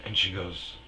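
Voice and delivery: normal talking voice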